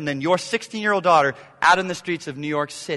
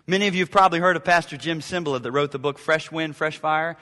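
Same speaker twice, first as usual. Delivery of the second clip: normal talking voice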